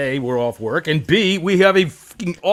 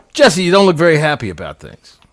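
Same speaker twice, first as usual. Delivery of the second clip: ordinary talk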